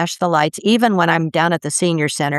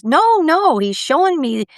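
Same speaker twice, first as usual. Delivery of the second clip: eager insistence; 10 semitones higher than usual. Retold or imitated. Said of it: "He says".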